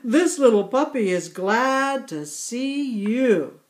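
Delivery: a jolly grandpa laugh in the words. A narrator's reading. Grandpa Yak laughing as the puppy licks Yalta, jolly chuckle. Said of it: "Grandpa Yak began to laugh".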